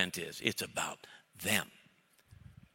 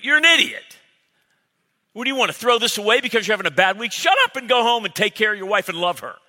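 much louder, higher hammed-up shouting; mock yelling friend; 8 semitones higher, 8 dB louder than usual. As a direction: bellow it at him like a buddy. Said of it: "That's how men talk".

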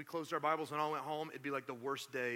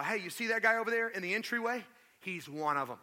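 pointing him out; 6 semitones higher, 6 dB louder than usual. Acted. Servant girl pointing Peter out.

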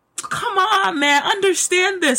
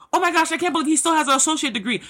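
quick, snide mimicry of a gossiping neighbour; lightly mocked gossip voice; mocking gossip; 6 semitones higher, much faster than usual. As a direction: rattle it off like a nosy busybody. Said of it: "people who may be mocking you".

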